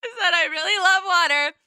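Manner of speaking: high, laughing replay of her own line; cracking up at her own opening line; laughing while retelling. Retold or imitated. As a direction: giggle through the quote.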